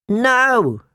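high startled squeak. Acted. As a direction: blurt it out in a squeak. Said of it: "Samwell Tarly squeaked".

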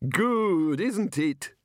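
high, pleased character voice; happy. A narrator's reading. Arch-Chancellor proud of his odd tower, happy. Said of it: "said the Arch-Chancellor happily".

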